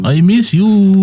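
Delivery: high, slow alien voice; slow and high, like a homesick alien. Acted. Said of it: "I can do a good alien impression".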